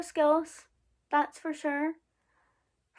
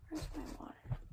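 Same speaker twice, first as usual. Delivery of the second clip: muttered aside; 6 semitones higher, 12 dB quieter than usual. Real, not acted. Aside while searching for water.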